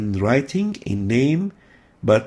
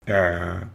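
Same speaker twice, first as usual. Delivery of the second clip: a squeezed ع from deep in the throat; like someone choking you; صوت العين الحلقي; choking throat sound; 5 semitones lower than usual. Shown as a demonstration. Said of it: "coming from the throat".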